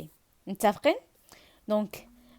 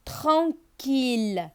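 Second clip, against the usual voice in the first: tranquille stretched out slowly; tran-quille, said slowly and clearly; slow syllable demo; 2 semitones higher, much slower than usual. Shown as a demonstration. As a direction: spell out tranquille with your voice for pupils. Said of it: "teacher models a word".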